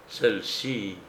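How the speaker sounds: ordinary delivery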